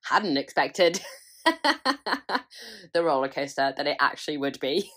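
slow, laughing through the words; giggling at herself. Real, not acted. Laughing about her struggle.

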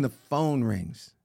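slow, low storytelling beat; dramatic slow. Retold or imitated. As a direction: draw it out for suspense.

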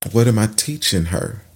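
ordinary talk, nothing marked

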